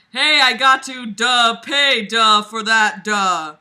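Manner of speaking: loud, goofy dopey drawl with duh; dopey Davis voice. Retold or imitated. Mocking a book character's dopey line, loud and goofy. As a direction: ham up a dimwit saying duh. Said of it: "he said with his amazing comedic timing".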